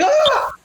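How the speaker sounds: high-pitched panicked squeal; comic high shriek of alarm; Beaker-like squeal. Acted. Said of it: "He's like fucking Beaker".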